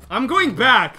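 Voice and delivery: loud, high outburst; determined shout. Real, not acted. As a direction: shout it as a frustrated vow. Determined yell after dying.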